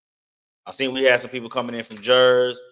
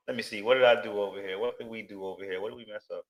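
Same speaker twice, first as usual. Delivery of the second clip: ordinary talk, nothing marked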